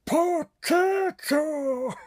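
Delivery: high, slow, drawn-out potato voice; potato impression. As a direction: stretch it out high like a talking potato. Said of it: "That was my impression of a potato".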